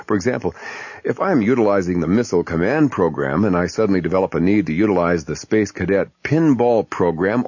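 normal talking voice

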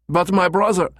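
put-on high, protesting German accent. Caller tries to object, high German accent. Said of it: "in his German accent".

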